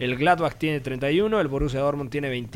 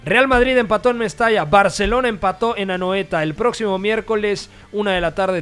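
excited announcing; 5 semitones higher, 5 dB louder than usual. Real, not acted. Radio host announcing results.

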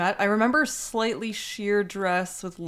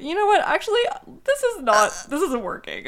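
higher, louder panicked teacher backing out; a flustered teacher suddenly calling it off; 9 semitones higher, 5 dB louder than usual. Retold or imitated. Male teacher abandoning the sex ed search lesson, higher and louder, flustered. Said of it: "my teacher was like".

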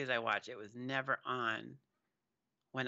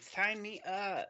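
mock executive; 8 semitones higher, much slower than usual. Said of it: "Movie executives are just like".

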